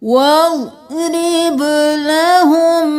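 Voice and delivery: slow, sung Quranic chant with tajweed; slow chanted tilawa; Quran recitation. Shown as a demonstration. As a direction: draw out every vowel in melodic recitation.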